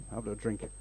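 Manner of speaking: usual speaking voice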